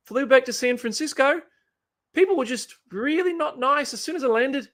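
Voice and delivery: attempted New Zealand accent, sounds like Cork. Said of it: "the only New Zealand accent I apparently know is Cork".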